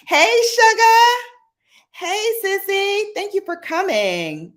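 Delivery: high, loud, bright-eyed hello to viewers; excited greeting. Real, not acted. Greeting viewers joining the live, excited and high. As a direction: sing out a welcome to friends joining.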